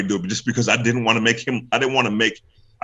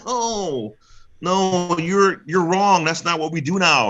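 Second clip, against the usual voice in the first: high, drawn-out mock correction; mock objectors; 10 semitones higher, slower than usual. Host mimicking critics correcting his old story, mock indignant. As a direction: imitate a know-it-all insisting, pitched way up. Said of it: "people are gonna be like".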